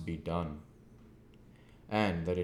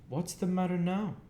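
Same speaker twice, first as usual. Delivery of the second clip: smart sister; 13 semitones higher, 4 dB louder than usual. A narrator's reading. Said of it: "said she, smartly".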